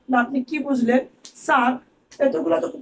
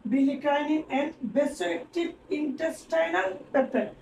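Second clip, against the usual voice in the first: very slow reading; much slower than usual. Shown as a demonstration. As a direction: drag out each term slowly. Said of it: "এটা তো উচ্চারণই করতে পারতেছি না".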